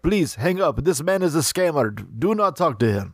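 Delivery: lightly imitated Russian accent, pleading politely; mock Russian accent. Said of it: "a really nice Russian guy".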